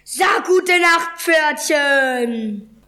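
high, slow taunting shout; roared threat. A narrator's reading. Club-swinging attacker threatening a zebra, drawn-out shout. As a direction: bellow it slowly, high then falling away. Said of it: "und brüllte".

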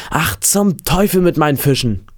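upset boy, lightly acted; mild outburst. A narrator's reading. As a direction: curse it in mild vexation. Boy curses his own catch.

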